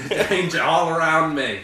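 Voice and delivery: mock Steve Irwin. Friend clowns as Steve Irwin warning of danger in an Aussie accent. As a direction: play a wild Aussie croc hunter. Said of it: "Steve Irwin on acid".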